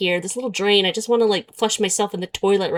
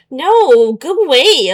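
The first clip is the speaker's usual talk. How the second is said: louder, higher, shooing it away; annoyed brush-off; 5 semitones higher, 7 dB louder, slower than usual. Retold or imitated. Brushing off the AI boyfriend, louder and annoyed. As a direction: shut the chatbot down. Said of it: "and I'm like".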